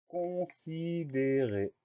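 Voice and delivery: CONSIDÉRER very slow, stretched as con-si-dé-rer; dictation-style "considérer", each syllable held long. Shown as a demonstration. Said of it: "Première syllabe".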